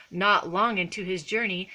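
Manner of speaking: ordinary delivery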